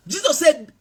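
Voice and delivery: normal talking voice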